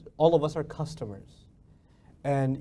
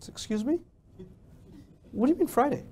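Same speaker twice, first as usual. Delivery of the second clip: higher, incredulous employee questioning the boss; mock incredulity; 6 semitones higher, 3 dB quieter than usual. Employee answering the boss's Friday deadline, mock incredulous. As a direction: play an employee balking at a deadline.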